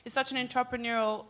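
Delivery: normal talking voice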